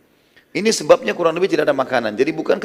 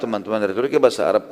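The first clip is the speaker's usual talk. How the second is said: ordinary delivery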